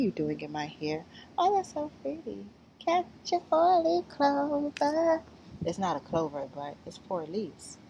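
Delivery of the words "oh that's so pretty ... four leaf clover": high, slow, sing-song coo at a tiny bug. Real, not acted. Cooing at the bug that landed in her hair, high and slow.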